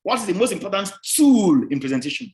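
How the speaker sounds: loud, high, stretched "tool" sliding down; stress on tool. Shown as a demonstration. Lecturer repeating the quiz question, strong stress on "tool". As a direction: make "tool" the big word of the question. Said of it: "the emphasis is tool".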